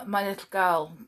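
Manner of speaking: plain reading voice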